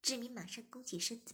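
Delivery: usual speaking voice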